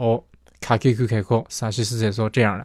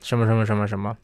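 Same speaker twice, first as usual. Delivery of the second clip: ordinary delivery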